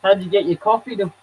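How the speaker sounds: ordinary delivery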